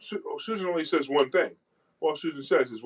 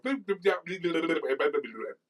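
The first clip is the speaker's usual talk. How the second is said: rapid nonsense babble at a machine; mock garbled bleep noises; imitated bleeping gibberish; 4 semitones higher, 3 dB quieter than usual. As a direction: babble bleeps to confuse the phone robot. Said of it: "I go".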